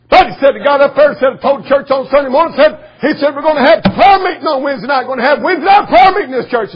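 loud, high preaching shout; fired-up preaching. Acted. Preacher retelling a young boy's sermon, full-force shout.

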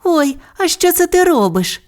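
put-on high childlike voice asking; curious little Bu. A narrator's reading. Bu asks Bao, high and curious. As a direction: Bu curious about paper figures.

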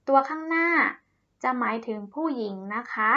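ordinary talk

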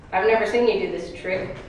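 normal talking voice

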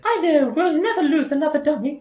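high, loud put-on voice of Cass; Cass's voice. Artist voicing her friend Cass in the comic, high and loud. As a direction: squeak it out as the excitable friend. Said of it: "that's how Cass talks".

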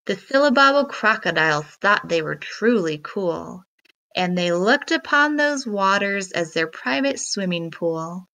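usual speaking voice

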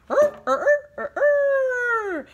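loud, high, drawn-out cock-a-doodle crow; rooster crow. Acted. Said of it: "Rooster crowed".

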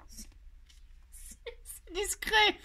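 stretched-out high whine, very slow; mock whine. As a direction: drag it out like a sulky protest. Mock insisting the bow is discreet.

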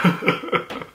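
high, breathy fake sobs; hammed-up crying noise; mock sobbing. Crying himself to sleep that night, fake sobbing. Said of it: "crying, like".

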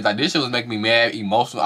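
ordinary delivery

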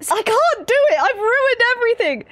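high, loud mock wail; mock despair. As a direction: lament the botched accent in hammed-up anguish. Mock despair at botched accent.

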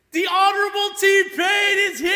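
high, loud, elated heralding; triumphant shouted announcement. Real, not acted. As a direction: shout out the arrival of a guest of honour. Heralds [name]'s arrival to viewers.

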